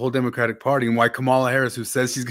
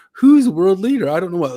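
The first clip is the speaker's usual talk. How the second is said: much higher and louder, mock-baffled; playing dumb; 8 semitones higher, 8 dB louder than usual. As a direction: act fake clueless, pitched way up. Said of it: "he just played dumb".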